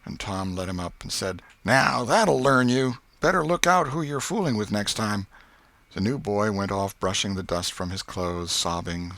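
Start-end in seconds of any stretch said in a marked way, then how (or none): 1.6-5.3 put-on boyish gloating. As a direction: crow over the loser. Tom gloating over beaten boy.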